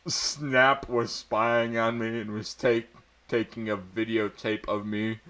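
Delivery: normal talking voice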